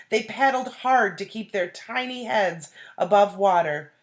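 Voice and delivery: loud, urgent read-aloud peril; dramatic story narration. A narrator's reading. Teacher reading the whirlpool scene, loud and urgent.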